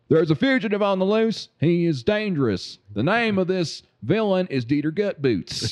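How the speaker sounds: deadpan manhunt announcement; Tommy Lee Jones impression. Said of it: "Tommy Lee Jones".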